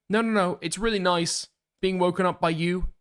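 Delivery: high, reassuring put-on voice; reassuring texter. Streamer voicing a text welcoming the wake-up, high reassuring voice.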